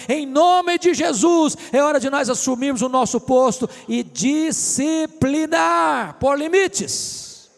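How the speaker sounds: ringing, emphatic pulpit call; forceful preaching. Real, not acted. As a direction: exhort the congregation at full voice.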